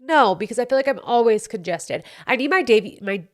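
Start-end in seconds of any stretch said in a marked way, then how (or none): none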